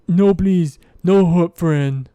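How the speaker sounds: loud, pleading simple-minded Verbeeg; tearful, pleading monster. Acted. Verbeeg begs the party not to hurt his friend, tearful pleading. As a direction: beg loudly, in simple broken speech. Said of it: "there are tears in his eyes".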